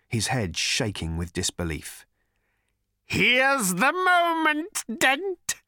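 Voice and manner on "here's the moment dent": very high, slow hateful shriek. A narrator's reading. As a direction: shriek at a feverish pitch. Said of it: "shrieked the voice".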